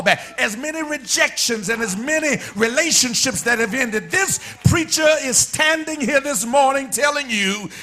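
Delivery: rolling preacher cadence, building; preaching. Acted. Preacher urging the congregation not to give up, building sermon cadence. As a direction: preach it, stacking each line.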